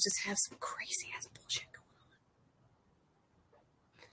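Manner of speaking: hushed conspiratorial whisper. Real, not acted. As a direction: whisper it like a secret. Gossipy aside about small towns.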